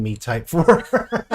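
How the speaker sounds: words dissolving into laughter; breaking into laughter. Real, not acted. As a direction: read it while starting to crack up. Breaks into laughter while reading.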